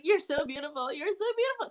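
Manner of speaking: high-pitched mock-tearful gushing; retold blubbering. Fan gushing at her idol in a retold story, mock blubbering. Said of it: "like, blubbering".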